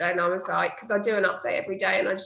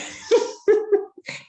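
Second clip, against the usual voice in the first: breathy high laugh; 12 semitones higher, 4 dB louder than usual. Real, not acted. Laughing at the mock threat.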